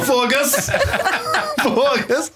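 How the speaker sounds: put-on high giggly toddler "foga-se"; child's foga-se. Father imitates daughter again, high, laughing.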